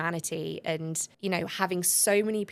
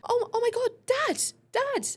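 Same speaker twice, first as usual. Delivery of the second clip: much higher, louder, thrilled calling out Dad; excited cry to dad; 5 dB louder than usual. Retold or imitated. Runner spotting her dad in the crowd, thrilled high cry. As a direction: squeal it as she spots him. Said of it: "she was like".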